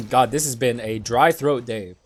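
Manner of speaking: ordinary talk, nothing marked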